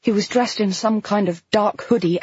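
ordinary delivery, nothing marked

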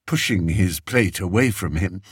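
ordinary delivery, nothing marked

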